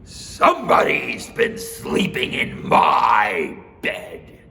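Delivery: slow, loud, gruff bear; Papa Bear voice. Acted. Papa Bear warning his family, slow and loud. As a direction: growl out the warning slowly.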